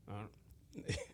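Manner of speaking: a faltering, trailing-off mock answer from a stumped scientist. Retelling how scientists answer, a mock stumped shrug. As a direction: play the expert who has no clue.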